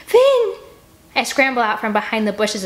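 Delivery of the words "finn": relieved shout. Acted. Annie calling out to Finn, loud relieved shout. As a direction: shout his name high and loud.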